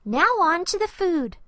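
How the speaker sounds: put-on a boy pleased with himself, moving on; eager little boy. A narrator's reading. Andy proud of his progress.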